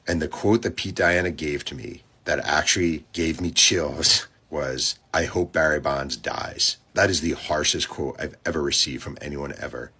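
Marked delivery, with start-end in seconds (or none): none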